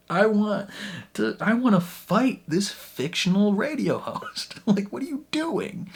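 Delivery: high, laughing, half-serious rage; laughing fury. Real, not acted. Viewer wanting to fight the host, laughing high rage. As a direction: laugh through the anger up high.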